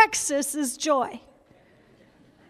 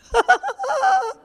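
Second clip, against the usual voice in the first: high delighted laugh; high burst of laughter; 12 semitones higher than usual. Real, not acted. Laughing at the absurdity.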